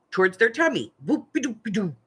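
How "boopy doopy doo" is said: put-on silly baby-talk voice. Shown as a demonstration. Parent pumping baby's feet, silly croon. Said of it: "with a silly little voice".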